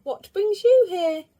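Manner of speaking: put-on high, loud cheerful little-girl voice; a sunny girl calling hello to a visitor; Little Miss Sunshine. A narrator's reading. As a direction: greet him brightly, way up high. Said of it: "said Little Miss Sunshine".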